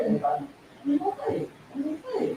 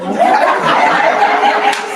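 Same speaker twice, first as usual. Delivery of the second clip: laugh; 3 semitones lower, 11 dB louder than usual. Real, not acted. Speaker amused by her own story, loud laughing. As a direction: burst out laughing hard.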